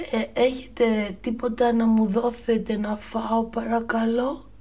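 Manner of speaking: put-on a timid child begging food; polite Jack. Jack asking the giant woman for food, high polite boy voice. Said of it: "της είπε".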